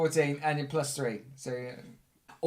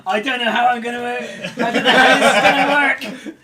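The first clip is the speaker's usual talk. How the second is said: hammed-up panic, much higher and louder; mock frantic; 9 semitones higher, 14 dB louder than usual. Game master joking about his rulebook scramble, frantic mock panic. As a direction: wail it loudly through laughter. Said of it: "frantically flipping through my rulebook going".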